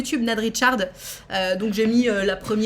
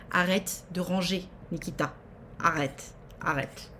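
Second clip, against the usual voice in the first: soft pleading scold; 4 semitones lower, 8 dB quieter than usual. Real, not acted. Scolding the Sim.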